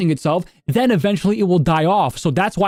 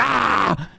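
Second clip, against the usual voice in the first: high-pitched sudden "ah" yell; startling mock scream; 6 semitones higher than usual. Imitating someone yelling at random passers-by, mock scream. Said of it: "walking up to random people and be like".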